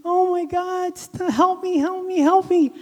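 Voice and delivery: a high, loud retold scream for help; a boy caught in a wasp hole screams for help. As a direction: yell for help as if the stings are happening now. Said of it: "I was screaming".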